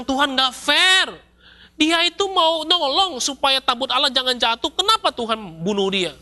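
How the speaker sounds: high, protesting complaint against God; angry Moses. Retold or imitated. Moses protests to God, high and indignant. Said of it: "Nabi Musa marah sekali".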